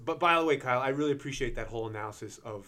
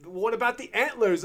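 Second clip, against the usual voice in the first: Grover voice; 9 semitones higher, 5 dB louder than usual. Retold or imitated. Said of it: "Grover asks".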